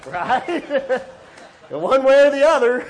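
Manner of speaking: high, loud words through real laughter; laughing at his joke. Real, not acted. Pastor laughing with the congregation at his joke, real laughter. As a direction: crack up at your own punchline.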